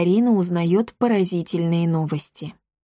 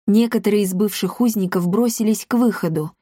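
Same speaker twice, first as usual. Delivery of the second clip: plain reading voice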